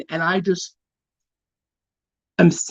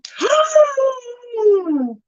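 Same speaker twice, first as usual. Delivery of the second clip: long high gasping cry. Real, not acted. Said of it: "You're making me".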